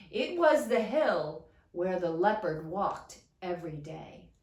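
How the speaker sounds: usual speaking voice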